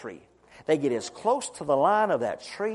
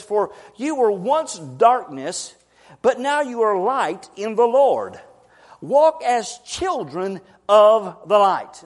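slow, louder solemn reading; scripture reading; 6 dB louder, slower than usual. Acted. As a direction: proclaim the verse.

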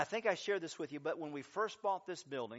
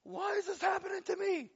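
much higher, wailing complaint; whiny despair; 4 dB louder than usual. Retold or imitated. Believer cries out to God: high whining lament. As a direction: play the self-pitying believer, high and strained. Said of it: "And we're thinking".

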